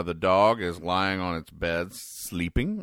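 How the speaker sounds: ordinary delivery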